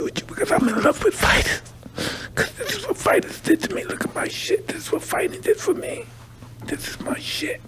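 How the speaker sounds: urgent hushed whisper, voice gone breathless; half-whispered, showing his scars. Real, not acted. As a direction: raw whispered confession. Showing his scars, emotional confession.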